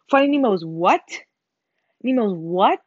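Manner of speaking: daring someone to repeat it; mock-offended challenge, loud on "what"; confrontational disbelief. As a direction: punch "what" with a sharp rise. Challenging an imagined Nemo hater.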